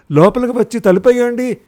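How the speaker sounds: loud, high urgent shout. A narrator's reading. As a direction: yell it over the howling wind. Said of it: "గట్టిగా అరిచి చెప్పింది".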